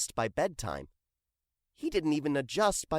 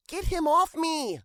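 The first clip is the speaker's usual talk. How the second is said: high, louder squashed plea; panicked plea; 3 dB louder than usual. Acted. Janie begs Hank to get the dog off her. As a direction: beg to be rescued.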